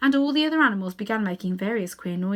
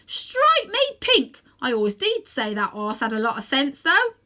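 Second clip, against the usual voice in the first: cabby's exclamation; 5 semitones higher, 7 dB louder than usual. A narrator's reading. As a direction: blurt it out in amazement, cockney cabby.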